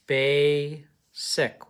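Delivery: "Basic" as bay, pause, sick, with long A; split into syllables. Shown as a demonstration. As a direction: say "Basic" in two slow, separate beats. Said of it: "look at how I marked it".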